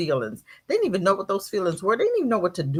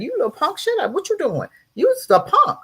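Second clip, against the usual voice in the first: scolding parent; 12 semitones higher than usual. Retold or imitated. Parent scolding crying boy.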